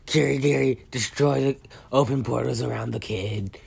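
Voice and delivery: bratty villain barking orders; Shigaraki. Acted. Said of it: "And Shigaraki's like".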